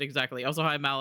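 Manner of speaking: ordinary talk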